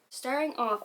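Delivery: normal talking voice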